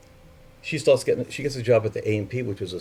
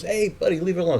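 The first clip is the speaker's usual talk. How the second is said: high, raised warning voice; tough guy warning; 9 semitones higher than usual. Retold or imitated. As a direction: warn him off, pitched high. Construction worker confronts him.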